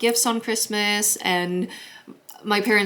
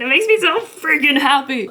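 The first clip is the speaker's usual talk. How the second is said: louder, higher burst of joy; excited gushing; 7 semitones higher, 5 dB louder than usual. Real, not acted. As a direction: let the delight burst out. Gushing about the Christmas decorations.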